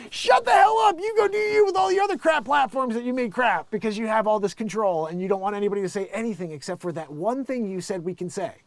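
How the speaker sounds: very high, loud mock shouting rant; mock furious rant. Mocking the censors with a shrieking rant. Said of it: "those people are like".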